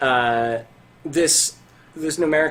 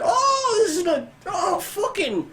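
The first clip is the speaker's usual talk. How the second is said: high, whiny merchant voice; squeaky miser griping; high squeaky shopkeeper. Acted. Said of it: "and he's like".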